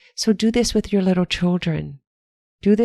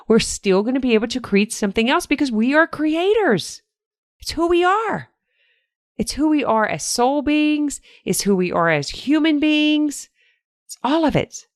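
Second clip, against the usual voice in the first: rising rally cry; 6 semitones higher than usual. Real, not acted. Coach rallying her listeners through the crisis, rising excited. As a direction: pitch climbing higher, fervent and uplifted.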